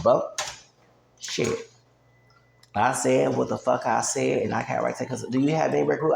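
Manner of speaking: usual speaking voice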